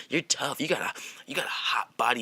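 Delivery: soft unvoiced whisper in the ear; imitated whisper. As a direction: breathe it right into his ear. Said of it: "so he's whispering in my ear".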